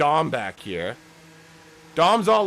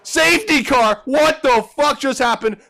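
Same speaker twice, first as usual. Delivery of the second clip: outraged yell; 9 semitones higher, 8 dB louder than usual. Pretend. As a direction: explode at the insult. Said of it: "Destroyer's like".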